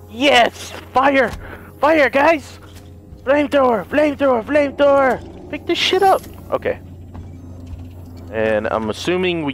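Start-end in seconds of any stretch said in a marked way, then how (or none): 0.1-6.2 high, thrilled yelps; ecstatic shouting. Real, not acted. Player finding a flamethrower, thrilled shouting. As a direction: shout like you found the best weapon.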